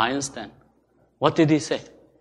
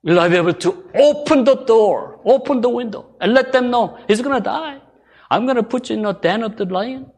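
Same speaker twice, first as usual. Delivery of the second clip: raised, emphatic pulpit voice; higher-pitched sermon challenge; impassioned preaching; 10 semitones higher, 7 dB louder than usual. Real, not acted. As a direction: press the challenge on the congregation.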